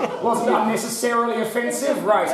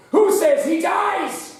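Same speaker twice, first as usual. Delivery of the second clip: arena emperor; 9 semitones higher, slower than usual. Acted. Host asks the audience to spare the player, in a grand voice. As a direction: call to the mob like a Roman emperor.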